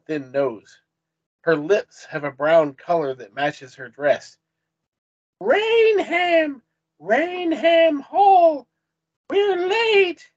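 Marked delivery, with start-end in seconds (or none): 5.4-10.3 high, loud, drawn-out cry; extremely loud, indignant English lady; angry Englishwoman. A narrator's reading. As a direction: wail it out angrily, high and stretched. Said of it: "she sounds angry".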